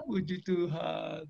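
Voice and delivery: slow, laughing through the words; laughing praise. Real, not acted. Preacher delighted by the story, slow laughing praise. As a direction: chuckle out your thanks.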